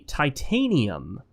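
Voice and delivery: "titanium" slowly as tie-TAY-nee-um; slow, deliberate "titanium", second syllable stressed; sounded-out word. Shown as a demonstration. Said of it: "what does it sound like".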